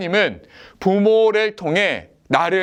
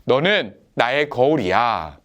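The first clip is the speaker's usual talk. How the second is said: put-on slow, clear line for the children to repeat; slow repeat-after-me line; 5 semitones lower than usual. Shown as a demonstration. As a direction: say it slowly so they can echo it. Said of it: "이렇게 한번 따라해 봅시다". (천천히 따라 하게 또박또박)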